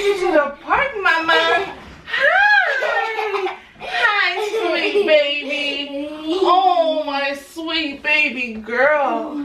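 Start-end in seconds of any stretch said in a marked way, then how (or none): none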